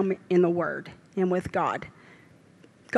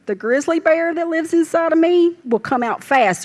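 raised, louder grizzly-bear warning; fierce warning; 6 semitones higher, 6 dB louder than usual. Real, not acted. Grandmother warning anyone who fusses at her kids, loud and fierce. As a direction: let the protective bear roar out, higher and louder.

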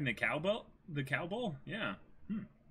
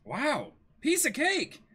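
squeaky, much higher carefree voice; cocky Luffy; 12 semitones higher, 4 dB louder, slower than usual. Acted. Luffy to the crew, carefree and much higher. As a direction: toss it off like it's nothing.

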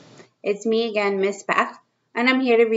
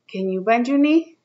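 put-on reading to children; 3 semitones higher, 3 dB louder than usual. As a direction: read it out to little kids, drawing each line out. Reads picture book to kids.

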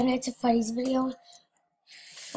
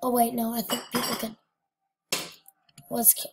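ordinary talk

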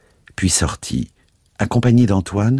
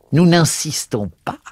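higher, louder sneering retreat; mocking; 10 semitones higher, 4 dB louder than usual. A narrator's reading. Priest to madame de Fontanin, louder, high and mocking. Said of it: "avec une intonation railleuse".

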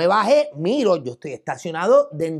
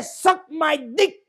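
high, drawn-out retold jeer; mischievous taunt; 8 semitones higher, much slower than usual. Provoking the driver just to mess with him, mischievous taunt. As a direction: stretch the taunt out slowly to annoy.

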